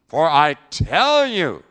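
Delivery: slow, loud, with "tell" punched; solemn loud declaration; loud, slow emphatic quote. Acted. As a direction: drive home "For I tell you". Quoting Jesus emphatically.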